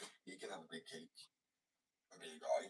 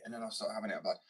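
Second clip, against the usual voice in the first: ordinary talk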